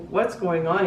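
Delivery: ordinary talk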